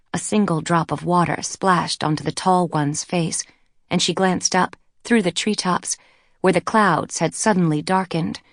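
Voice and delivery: ordinary delivery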